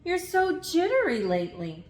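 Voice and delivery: lightly voiced concerned mom. A narrator's reading. Mom asking her jittery son what's wrong. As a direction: ask like a puzzled mother. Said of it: "his mom asked".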